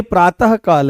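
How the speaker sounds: "प्रातः काल" said as praa-ta-ha kaal; visarga sounded as h. Shown as a demonstration. Teacher repeating for students, "प्रातः काल" with the ह sounded. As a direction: give the ः in "प्रातः काल" a clear ह. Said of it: "ये ह की ध्वनि दे रहे हैं".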